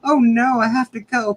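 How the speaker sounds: mock protest. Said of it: "I think it was more like that".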